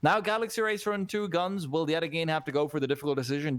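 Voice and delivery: usual speaking voice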